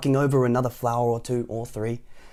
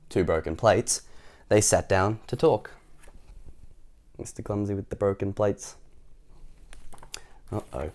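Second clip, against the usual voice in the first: usual speaking voice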